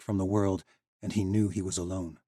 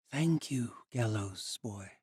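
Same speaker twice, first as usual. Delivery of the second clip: gentle thanks; 3 semitones higher, 4 dB quieter, much slower than usual. Acted. Ancient girl thanking him.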